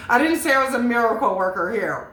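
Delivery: amused, self-excusing, laughing out loud. Real, not acted. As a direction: crack up, then talk through the laughter. Laughing at her own uncertain reading.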